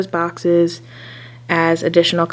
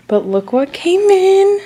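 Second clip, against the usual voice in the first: much higher, louder gleeful reveal; excited; 13 semitones higher, 5 dB louder than usual. Real, not acted. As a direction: burst with excitement at the delivery. Unveiling new delivery.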